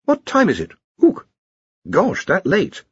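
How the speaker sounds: just woke up, startled by the time; startled waking. A narrator's reading. Just woken, startled by the hour.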